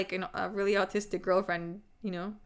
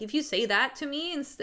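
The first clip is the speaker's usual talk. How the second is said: normal talking voice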